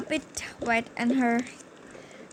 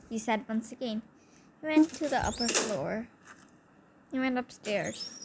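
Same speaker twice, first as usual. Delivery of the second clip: usual speaking voice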